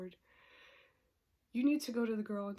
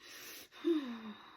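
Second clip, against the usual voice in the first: exasperated sigh. Real, not acted. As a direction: blow the breath out in frustration. Exasperated after saying fine.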